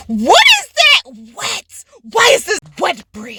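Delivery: high, loud mock outrage; stretched-out, appalled questioning with laughter breaking in; startled mom. Mom finding wet sanitizer on her bed, appalled.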